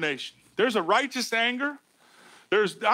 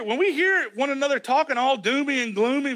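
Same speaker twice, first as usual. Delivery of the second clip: ordinary talk, nothing marked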